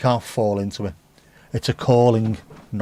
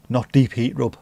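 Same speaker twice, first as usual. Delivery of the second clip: normal talking voice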